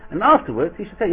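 ordinary delivery

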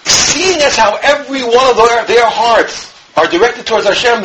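high-pitched, insistent preaching; impassioned preaching. Real, not acted. Preacher telling students we're all one team, impassioned.

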